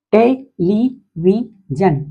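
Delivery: Television drawn out very slowly, te-le-vi-sion; teacher stretches television beat by beat; slow syllable split. Shown as a demonstration. Teacher to class, splitting television very slowly. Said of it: "polysyllabic word".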